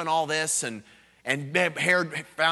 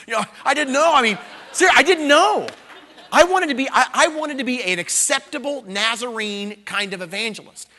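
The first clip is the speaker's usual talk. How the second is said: loud laughing shout, pitched up; preaching at full force; 7 semitones higher, 8 dB louder than usual. Real, not acted. Preacher shouting to the congregation about his past, loud and laughing. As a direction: push it out with a laugh, almost yelling.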